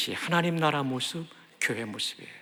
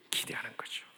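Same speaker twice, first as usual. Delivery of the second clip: whisper; 14 dB quieter than usual. Real, not acted. Intimate aside to congregation.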